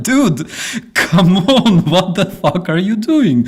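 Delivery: like yelling at a friend who's embarrassing himself, laughing; mock exasperated call. Mock calling out to Tucker.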